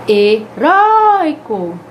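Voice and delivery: "heróico" very slow and loud, RÓI held long; exaggerated penultimate stress. Shown as a demonstration. Teacher gives another paroxytone, loud stretched RÓI in "heróico". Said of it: "Vamos ver bem pertinho aqui".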